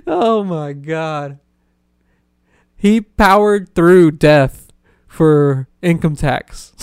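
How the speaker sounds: slow and loud through laughter; laughing so hard the words stretch out slow; laughing disbelief. Real, not acted. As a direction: drag it out, cracking up. Laughing at grandpa's joke.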